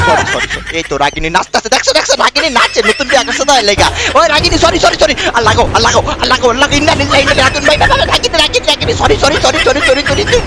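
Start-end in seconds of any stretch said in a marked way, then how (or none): 0.1-0.8 laughing apology. Real, not acted. Laughing apology to angry wife. 4.2-10.4 frantic begging. Real, not acted. Begging as wife attacks him.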